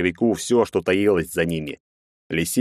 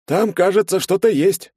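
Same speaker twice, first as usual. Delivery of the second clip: high, raised character voice, not whispered; fat man's alert; 13 semitones higher, 5 dB louder than usual. A narrator's reading. Fat man tells the searchers something is there, alert. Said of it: "шепнул толстяк".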